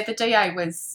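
usual speaking voice

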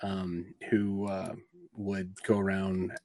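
usual speaking voice

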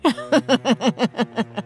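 a gleeful monster laugh; evil cackle. Acted.

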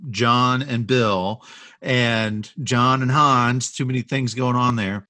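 normal talking voice

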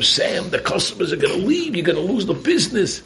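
high mock yell of a nagging wife; retold wife's scream. Wife yelling at her late husband, a high mock scream. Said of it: "she starts screaming at him".

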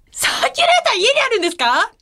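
high shouted disbelief; shocked shout. Real, not acted. Shocked asking staff. (驚いて叫ぶように)

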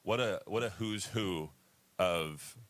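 usual speaking voice

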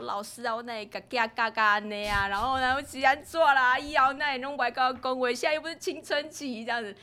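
loud, high blubbering imitation; mock tearful wailing. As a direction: blubber garbled complaints to the counsellor, hammed up. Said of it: "哭了一把鼻涕一把眼泪". (夸张模仿一把鼻涕一把眼泪地哭诉)

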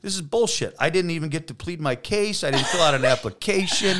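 high-pitched comic grievance; mock outrage, voice climbing; indignant protest. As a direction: argue it like a wronged man, hammed up. Mock protest at being in hell.

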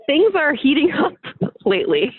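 laughing through the words. Real, not acted. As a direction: chuckle while saying it. Wry remark on online feuds.

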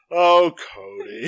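loud, drawn-out mock lament; mock dismay. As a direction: stretch it out like a hammed-up groan. Retelling own mock-pitying reaction.

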